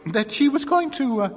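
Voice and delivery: high, flustered and hesitating; flurried. A narrator's reading. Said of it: "in a flurried voice".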